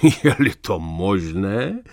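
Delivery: slow, amused, mocking echo; condescending laugh. A narrator's reading. Old man scoffs at the narrator's doubt, laughing. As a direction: laugh down at the question. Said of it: "zasmál se povýšeně".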